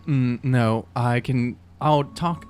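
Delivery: ordinary delivery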